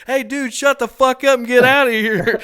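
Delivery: high, loud exasperated yell; Ryan. Retold or imitated. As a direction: imitate a fed-up buddy shouting someone away. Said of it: "I can hear Ryan go".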